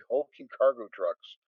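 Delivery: ordinary talk, nothing marked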